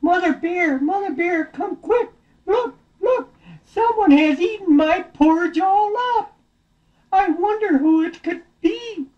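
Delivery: high-pitched story-bear shout; shouting Daddy Bear. Acted. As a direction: call out urgently in a very high voice. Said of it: "Daddy Bear shouted".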